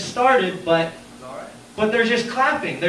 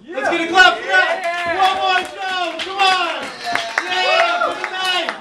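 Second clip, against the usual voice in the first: much higher, pumped-up crowd call; comic begging the crowd for applause; hyping the crowd; 12 semitones higher, 3 dB louder, slower than usual. Real, not acted. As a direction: whip the audience into clapping. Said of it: "hyping the crowd".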